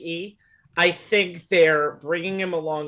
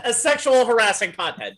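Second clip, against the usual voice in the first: higher, amused through laughter; laughing; 6 semitones higher, 4 dB louder than usual. Real, not acted. Joking with co-hosts about a wrestler, laughing delivery. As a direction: crack up while getting the words out.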